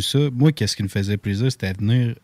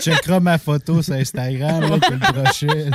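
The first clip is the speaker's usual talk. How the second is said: laughing; 6 semitones higher than usual. Real, not acted. Laughing while bragging about fish.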